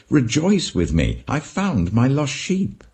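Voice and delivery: a shepherd overjoyed, sharing the find; joyful. Acted. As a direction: call out the good news with delight. Shepherd's joyful call to friends.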